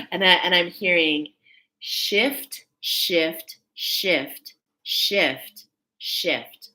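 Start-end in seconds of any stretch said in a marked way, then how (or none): 1.8-6.7 "shift" as separate, evenly spaced beats, falling; repeated emphatic word. Real, not acted. Reader relaying what she hears, "shift" in measured beats. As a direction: say each "shift" alone, with a pause after it.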